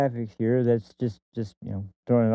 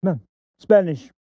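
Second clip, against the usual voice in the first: higher, louder put-on clerk voice; mock clerk voice; 6 semitones higher, 6 dB louder than usual. DMV clerk correcting Al, in a raised put-on voice. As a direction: say it like the clerk stating the obvious. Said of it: "the guy goes".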